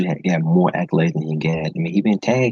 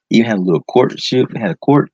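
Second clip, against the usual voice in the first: ordinary talk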